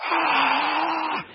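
high loud mock baby shriek; baby scream. Father imitates his baby son screaming on the changing table, high shriek. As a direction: let out a baby's sudden wail.

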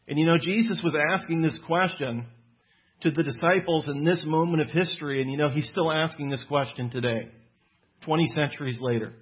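ordinary talk

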